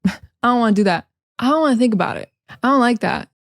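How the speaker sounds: fast, casual "don't" with no D; reduced American "I don't"; dropped D. Shown as a demonstration. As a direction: run "I don't" together, leaving out the D. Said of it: "we drop the D and say".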